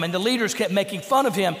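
normal talking voice

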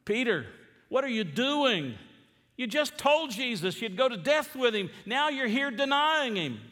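high-pitched, exasperated rebuke; incredulous scolding. Acted. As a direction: scold a friend in disbelief, pitch climbing. Preacher scolds Peter as if present.

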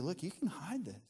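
soft, sly whispering tempter; mock whisper. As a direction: coax softly, like sharing a secret. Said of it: "the enemy will whisper to you".

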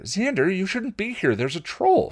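high, alarmed exclamation; Anya exclaiming. Acted. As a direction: warn him in a rush. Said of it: "she exclaimed".

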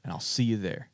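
put-on an Aussie hamming up a country Southern American accent; mock Southern American accent, lightly done. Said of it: "give us your best American accent".

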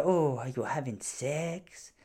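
slow, soft, prying judge voice; pry into the couple's sex life, drawn out; lightly voiced prying judge; nosy judge. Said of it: "She go".

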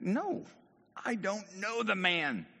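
high-pitched, drawn-out denial; acted insistent disavowal; emphatic denial. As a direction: deny it slowly, word by word. Acts Peter's first denial.

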